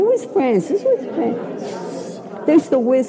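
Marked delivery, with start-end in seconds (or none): 0.0-0.8 high mock calling of girls. Girls searching, calling for her. 0.8-2.2 slow whispered mock call. Girls searching, calling for her.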